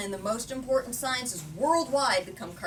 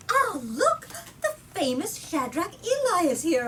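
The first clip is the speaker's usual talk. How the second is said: much higher and louder, thrilled gasp of recognition; excited spectator; 7 semitones higher, 6 dB louder than usual. Acted. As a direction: call it out delighted, like spotting a celebrity. Excited spectator spots famous man.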